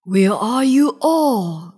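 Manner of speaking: loud, slow lion roar. A narrator's reading. Lion hunts the hiding animals, loud roar. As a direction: roar out for hidden prey. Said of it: "roared the lion".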